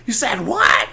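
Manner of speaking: high, loud imitation of a shocked mom; mock scream. Mom hears what her son said, mock screaming. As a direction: screech it as an outraged mother. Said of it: "the mom starts screaming".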